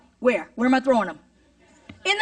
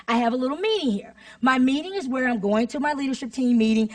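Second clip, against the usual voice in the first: ordinary delivery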